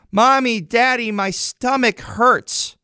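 mock a little boy calling for his parents, the last words drawn out slowly; kid calling out. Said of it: "he yells from his bedroom".

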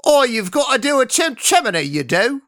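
high, loud Cockney sing-song; mock Cockney. Shown as a demonstration. Podcaster showing co-host how it goes, exaggerated Cockney. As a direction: lay on a broad Cockney accent. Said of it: "You're not Cockney".

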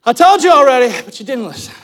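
high, loud, indignant retort; fed up and yelling it; exasperated shout. Acted. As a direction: raise the voice in exasperation. Man retorts to his questioners.

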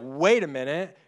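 loud, mildly indignant master cutting in. Retold or imitated. Master stopping an unforgiving servant, loud mock indignation. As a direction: pull the servant up short, loudly. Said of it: "then the master's like".

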